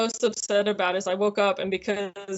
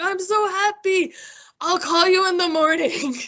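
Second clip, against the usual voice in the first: a much higher, teary happy friend; imitating best friend; 10 semitones higher than usual. Woken best friend hearing the news, mock teary and happy.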